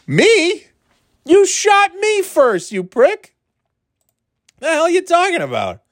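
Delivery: high-pitched, outraged squawk of disbelief; mock indignation. Player retorting to the man who shot him first, indignant. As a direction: throw the blame back, voice shooting up high.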